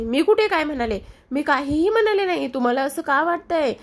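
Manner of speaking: innocent denial. Acted. Kavya denies calling him names.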